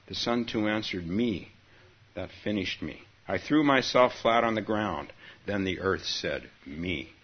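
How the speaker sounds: expressive storyteller reading; story narration. Acted. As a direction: tell the tale with feeling. Reads koan story aloud.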